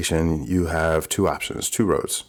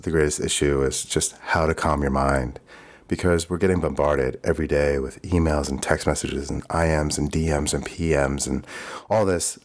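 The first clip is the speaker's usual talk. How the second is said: normal talking voice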